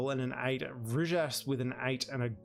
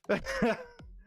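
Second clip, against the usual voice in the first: short laugh; 9 semitones higher than usual. Real, not acted. Rueful laugh at Lyon exit.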